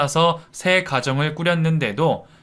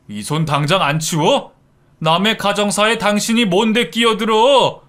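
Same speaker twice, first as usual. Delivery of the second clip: rising angry shout; angry yell; 5 semitones higher, 4 dB louder than usual. A narrator's reading. Husband yelling at the man blocking him, getting louder. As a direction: yell at the stranger. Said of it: "하고 소리질렀어요".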